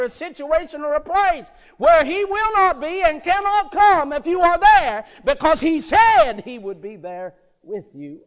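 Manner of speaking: loud, high-pitched sermon climax; impassioned preaching. Real, not acted. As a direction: proclaim it to the congregation at full force. Preaches at full force.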